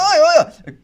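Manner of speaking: squeaky very high, loud taunt; shrill needling voice; mock jabbing dad. Dad poking him down the train, mock taunt.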